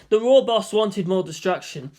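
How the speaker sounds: ordinary delivery, nothing marked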